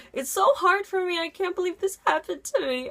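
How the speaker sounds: high, tearful whimper; imitated crying, voice cracking. Teacher crying on the phone, mock tearful cracking voice. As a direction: mimic a teacher crying, voice breaking. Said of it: "like, cracking".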